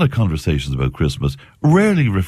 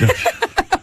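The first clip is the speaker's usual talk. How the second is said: high laugh. Real, not acted. Radio host laughing at joke.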